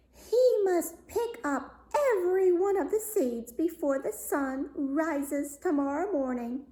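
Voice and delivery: high, haughty princess voice; a spoiled royal laying down an impossible order, pitched high; proud princess. A narrator's reading. Proud princess demands a task.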